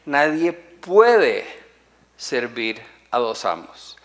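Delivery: "puede" lento y más fuerte, alargado; énfasis en puede. Shown as a demonstration. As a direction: marca despacio "Nadie puede". (acento fuerte en "puede", dicho despacio)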